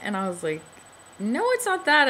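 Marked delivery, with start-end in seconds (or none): 1.2-2.1 high, quick protest; startled denial. Retold or imitated. Patient protests to the nurse, high and startled. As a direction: rush to correct her, caught off guard. Denies not wanting baby.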